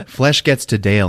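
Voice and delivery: ordinary delivery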